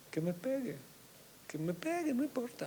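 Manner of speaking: high, soft, defiant pleading; defiant young self. Retold or imitated. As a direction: throw it off high and careless.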